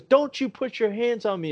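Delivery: high-pitched retold threat; mock outrage. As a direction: imitate her indignant warning, voice high. Retold woman warning him off.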